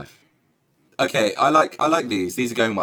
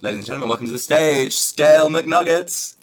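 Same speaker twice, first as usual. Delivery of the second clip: drag show announcer; 7 semitones higher, 6 dB louder than usual. Pretend. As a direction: hype up the drag act's entrance. Introducing a drag act.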